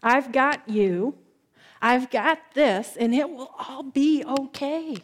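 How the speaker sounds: slow, calm comforting; gentle reassurance. Acted. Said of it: "when Jesus whispers your name and says".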